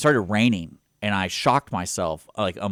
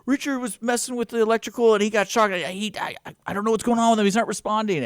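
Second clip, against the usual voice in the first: high, louder mock panicked brother; fake panic; 14 semitones higher, 3 dB louder than usual. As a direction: stammer it out in hammed-up alarm. Brother faking panic to mom.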